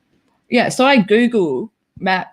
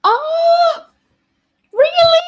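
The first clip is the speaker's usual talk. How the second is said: high-pitched delighted surprise; surprised; 5 dB louder, much slower than usual. Real, not acted. Reading chat, squealing with thrilled surprise.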